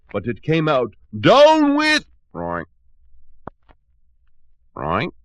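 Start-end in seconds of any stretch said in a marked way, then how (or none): 1.2-2.0 very high, very loud squeal; squealing shout. Acted. Butterbean mid-transformation, very high squealing shout. Voice turning into a pig. 2.3-2.6 pig grunt. Acted. 4.7-5.1 puzzled grunt. Acted.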